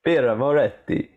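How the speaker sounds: exaggerated Italian flourish; hammed-up Italian accent. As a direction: say it like a mock-Italian beer advert. Hammed-up Italian beer name, then laughs.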